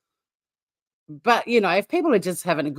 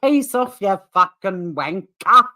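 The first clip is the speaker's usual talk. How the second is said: put-on overdone Aussie accent, slow and loud on wanker; mock Aussie accent; 5 dB louder, slower than usual. Imitating how he calls us Aussies, mock Aussie accent. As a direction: parody a bad Aussie accent, stretching the words. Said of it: "Aussie accent".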